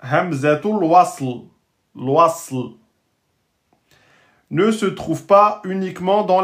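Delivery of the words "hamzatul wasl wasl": Hamzatul wasl, then wasl alone, distinctly; slow Arabic term. Shown as a demonstration. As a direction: pronounce the term carefully. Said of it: "La hamza instable".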